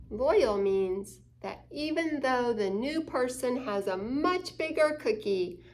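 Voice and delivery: ordinary delivery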